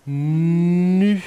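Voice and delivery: "Nu" with a very long humming n; loud, high, rising stretched "Nu"; exaggerated articulation. Shown as a demonstration. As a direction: hold the n of "Nu" so pupils hear the letter. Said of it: "Regardez mes lèvres".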